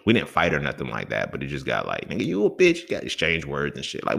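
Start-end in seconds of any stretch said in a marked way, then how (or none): 2.1-2.9 higher, retold heated jab; mock insult. Friends trading words, he retells a higher heated jab. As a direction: toss the insult up high like a taunt.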